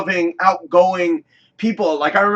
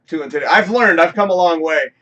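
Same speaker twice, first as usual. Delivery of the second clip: ordinary delivery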